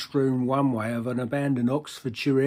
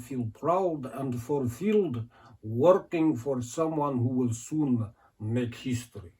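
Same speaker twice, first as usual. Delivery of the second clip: slow, sing-song Eastern European recruiter; Eastern European accent; 2 semitones higher, slower than usual. Acted. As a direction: make the pitch in a drawn-out Eastern European accent. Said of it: "I can't do the accent".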